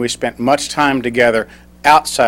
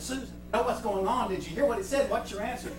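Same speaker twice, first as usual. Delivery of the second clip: high, very soft voice; imitated discreet questioning, pitched up and softened; hushed confidential imitation; 11 semitones higher, 14 dB quieter, faster than usual. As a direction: act out leaning in to ask quietly. Choir director leaning in to ask.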